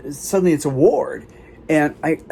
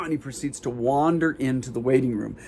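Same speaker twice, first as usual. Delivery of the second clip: normal talking voice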